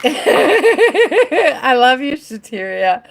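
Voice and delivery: laughing through the words. Real, not acted. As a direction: giggle it out warmly. Laughing while thanking viewer.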